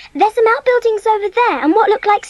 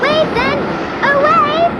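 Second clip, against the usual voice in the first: high, eager shout to the others; a child cheering as the train passes; excited call; 9 semitones higher, slower than usual. Acted. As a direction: urge everyone to wave now. Children wave at passing train.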